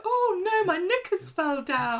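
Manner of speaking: hammed-up falsetto of a smitten woman; swooning woman. Sarah falls for the bad boy, hammed-up swooning falsetto. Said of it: "and Sarah's like".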